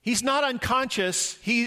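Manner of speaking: normal talking voice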